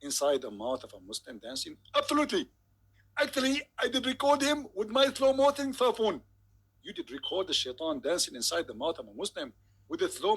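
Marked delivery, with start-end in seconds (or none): 2.0-6.2 Zakir Naik impression. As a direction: preach it with gleeful conviction. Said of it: "Doctor Zakir Naik".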